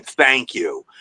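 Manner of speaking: emotionless, dismissive thanks; thanks with all feeling drained out; patronizing, cold. Retold or imitated. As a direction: imitate a cold, condescending thank-you. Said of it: "So patronizing, so cold, and so emotionless".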